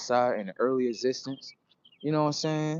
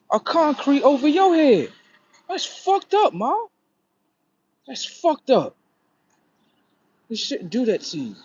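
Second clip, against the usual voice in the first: much higher and louder, real indignation; outraged; 10 semitones higher, 5 dB louder than usual. Real, not acted. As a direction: cry out at the injustice done to her. Outraged over Mother Nature's hurt.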